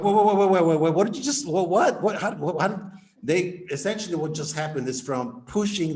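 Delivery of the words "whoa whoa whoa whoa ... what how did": higher and louder stunned stammer; flustered, loud, high amazement; stunned whoa. Retold or imitated. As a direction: act floored by the result, tripping over words. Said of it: "People go like".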